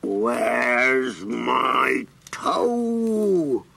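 very slow, low drawn-out groan; groaning creature. Acted. Creature groans to the mouse asking for its toe. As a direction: moan it like a monster. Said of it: "The creature groaned".

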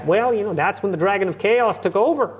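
viel höher, lauter, ernst dozierend; erkläre es wie Peterson, todernst; Jordan Peterson impression. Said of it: "stuff like him just coming home".

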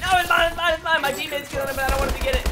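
high, insistent mock-greedy voice; greedy players. Gamer mocking players greedy for kills, high mimicry. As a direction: mimic kill-hungry players, high and urgent. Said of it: "And they're like".

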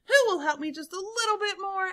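high, quick plea; calling out. A narrator's reading. Elephant appeals to the crowd, calling out. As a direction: send a hurried high appeal to onlookers. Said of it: "Elephant called out to the crowd".

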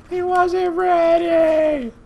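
high, very drawn-out mock cry; mock wail. Gamer mocking their own preschool meltdown, mock wail. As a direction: wail it slowly like an overwrought parent.

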